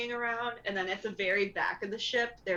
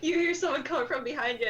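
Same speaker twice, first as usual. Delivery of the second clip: ordinary talk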